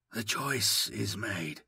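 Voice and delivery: soft, slow, thin words like cold wind. A narrator's reading. Newcomer settles the matter before leaving, soft and slow. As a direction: let it drift out quietly and slowly. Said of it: "His voice is strangely soft".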